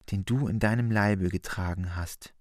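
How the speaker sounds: ordinary talk, nothing marked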